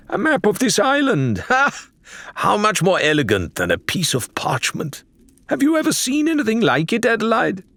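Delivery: high, fast, gleeful explorer voice; delighted Faisal. A narrator's reading. Faisal marvels at the map to Adelaide, giddy and high. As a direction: gush over the find like a giddy scholar.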